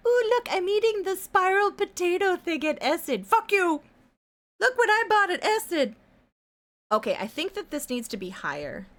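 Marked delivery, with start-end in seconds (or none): 0.1-6.0 high, mocking show-off friend voice; mocking bragging friends. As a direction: sneer at the bragging posts in a high voice. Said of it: "all my Facebook is just like".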